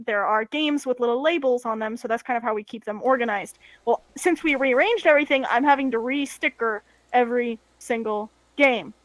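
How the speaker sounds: usual speaking voice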